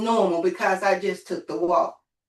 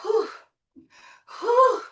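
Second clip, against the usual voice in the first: imitated winded breaths, the second one high; huffing and puffing; 13 semitones higher than usual. Retelling her winded walk to listeners, mock huffing breaths. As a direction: puff out air like you're winded from a walk. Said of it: "I was huffing and puffing".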